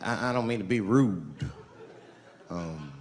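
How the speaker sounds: ordinary delivery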